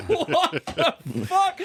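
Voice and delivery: high, slow, stretched-out astonishment; stunned disbelief. Retold or imitated. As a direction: draw it out in acted shock. Retold reaction to final twenty-five news.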